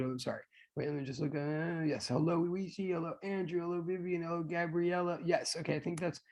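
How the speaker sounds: quiet, absent-minded murmur while checking; muttering to self. Real, not acted. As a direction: run through a checklist of names quietly. Checking who was greeted, to self.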